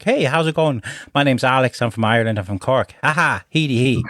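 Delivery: put-on lightly done Irish accent, Cork guy; a hint of a chirpy Irish introduction. Self wandering the house in a light Irish accent. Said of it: "wandering around the house like an idiot".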